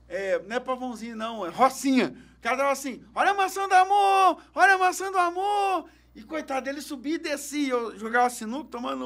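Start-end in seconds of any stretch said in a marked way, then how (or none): 3.1-5.9 higher and louder, a hawker's sing-song cry; street vendor's call. Retold or imitated. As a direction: call out your apples to the whole street. Imitates the vendor hawking. (pregão de vendedor ambulante, mais agudo e alto)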